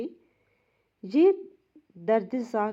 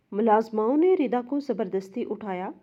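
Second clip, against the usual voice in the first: plain reading voice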